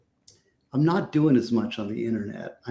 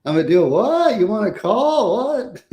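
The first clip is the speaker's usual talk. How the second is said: high, louder comic disbelief; incredulous impression, pitched way up; Norm Macdonald; 10 semitones higher, 4 dB louder than usual. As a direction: repeat it as baffled, high-pitched questions. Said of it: "everything he did, he's like".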